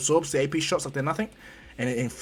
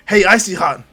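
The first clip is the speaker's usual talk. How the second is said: loud, higher holler; brash call; 4 semitones higher, 10 dB louder than usual. Acted. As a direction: yell roughly for a teammate. Bakugo calls to teammate.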